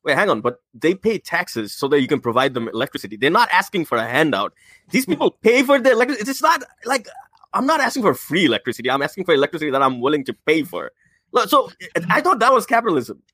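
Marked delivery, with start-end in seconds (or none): 4.9-13.2 mock townsperson. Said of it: "one of the lines that he used".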